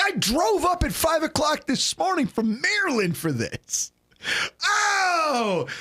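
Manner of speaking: high-pitched imitation of a crushed fan; mock devastated wail. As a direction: wail in disbelief, hammed up. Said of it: "he was devastated".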